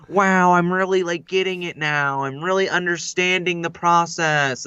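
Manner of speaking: flustered actor impression, slow; mock wonder, dragging the words out; Owen Wilson. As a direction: imitate a flustered, amazed movie star.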